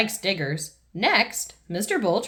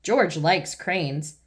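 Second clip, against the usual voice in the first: usual speaking voice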